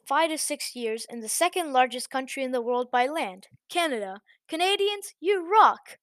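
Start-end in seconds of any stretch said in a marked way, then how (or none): 4.5-6.0 high, loud cheer to Canadians; excited shout-out. Real, not acted. Narrator cheering Canadian viewers, excited.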